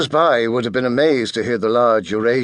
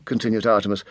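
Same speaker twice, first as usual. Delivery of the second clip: usual speaking voice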